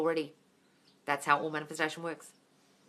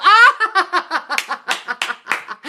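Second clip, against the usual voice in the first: delighted laugh; 12 semitones higher, 15 dB louder than usual. Real, not acted. Delighted by a funny comment.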